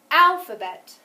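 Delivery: "Alphabet" with a loud, high first syllable, then falling; stress on first syllable. Shown as a demonstration. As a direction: really punch the start of "Alphabet". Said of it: "Listen one more time".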